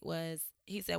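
normal talking voice

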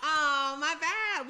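loud slow oh my bad; surprised apology. Real, not acted. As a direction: blurt it out, caught off guard. Caught leaving partner hanging.

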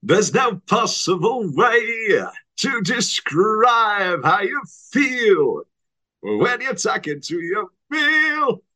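[name] impression. Bowie playing the wolf, hammed-up slow croon. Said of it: "[name] as the wolf".

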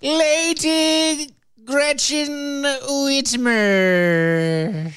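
very slow, high announcer drawl; grand proclamation. Acted. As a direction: stretch the title out grandly. Drawn-out proclamation with echo.

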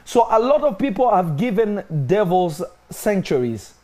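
high, loud, rising sermon; fiery preaching. Acted. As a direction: preach it with mounting fire. Preaching builds to full force.

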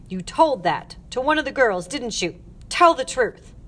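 loud, hard-stressed accusations; scolding tirade. Acted. Matron listing the girl's faults, angry. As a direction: berate her point by point, loudly.

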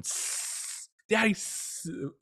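put-on a drawn-out hiss, then a high, slow little-kid voice; sounding out S like a kid. Father imitating his young son sounding out the S in Subway. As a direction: imitate a small boy sounding out a letter. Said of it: "he starts going".

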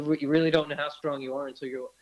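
usual speaking voice

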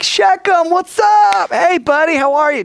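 high, loud, fake-chummy buddy voice; mock friendly greeting. Brothers faking friendship to Shechem, high chummy greeting. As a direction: play the scheming brothers all smiles.

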